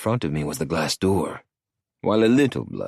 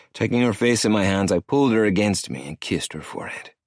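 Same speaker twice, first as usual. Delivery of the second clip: ordinary delivery, nothing marked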